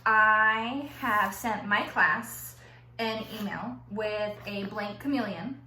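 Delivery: ordinary delivery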